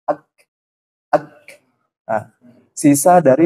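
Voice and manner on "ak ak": "Ak" with a modest puff after the k; moderate hams. Shown as a demonstration. Teacher showing just enough breath, lightly released kaf. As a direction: release just a little air on "Ak". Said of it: "tapi sekedarnya".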